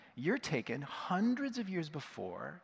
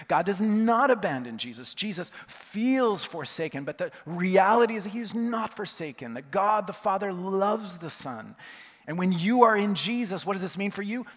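raised voice, higher and louder, building to the close; emphatic preaching; 4 semitones higher, 5 dB louder than usual. Real, not acted. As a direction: drive the promise home to the congregation. Preacher drives home the sermon's point.

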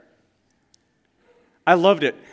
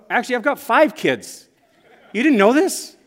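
higher and louder, offhand; casual reveal; 8 semitones higher, 7 dB louder than usual. Retold or imitated. Father tells the stunned coworker about five kids, offhand and higher. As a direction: toss it off like it's old news.

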